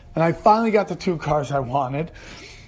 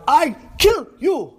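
much higher, louder, slow yell; broken-English scream; 10 semitones higher, 5 dB louder, slower than usual. Retold or imitated.

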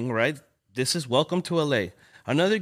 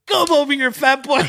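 much higher, louder laughing taunt; mocking taunt; 11 semitones higher, 7 dB louder than usual. Gangster taunting the chubby kid, loud laughing jeer. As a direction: jeer at him through a laugh.

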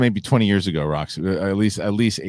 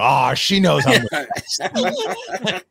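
slow, high, laughing brag; smug punchline; 8 semitones higher, much slower than usual. Retold or imitated. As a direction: stretch it out, cocky and amused. Punchline to the old lady.

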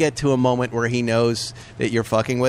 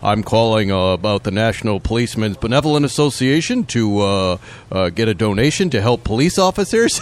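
low, halting fundraiser pitch; put-on officer voice with deliberate uhs; mock cop voice; 5 semitones lower than usual. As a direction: imitate a fake policeman soliciting by phone. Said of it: "tries to sound like a cop".

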